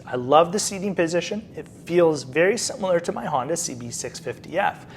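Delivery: ordinary delivery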